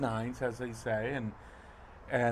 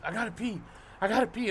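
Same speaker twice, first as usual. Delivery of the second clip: urgent friend; 9 semitones higher, faster than usual. Retold or imitated. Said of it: "my cousin's friend says".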